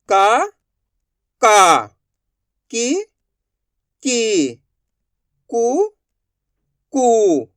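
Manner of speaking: alternating rising and falling syllables; loud, drawn-out drill with a pause after each syllable; repeat-after-me recitation. Shown as a demonstration. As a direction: recite the barakhadi for pupils to echo. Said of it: "मैं किस तरीके से इनका उच्चारण कर रहा हूँ".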